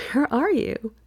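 asks again through a giggle; laughing question. Acted.

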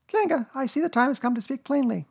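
very high voice trying to be brave; brave little Piglet. A narrator's reading. Piglet facing a cold bath, as brave as possible. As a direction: summon courage in a tiny high voice. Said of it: "in as brave a voice as he could".

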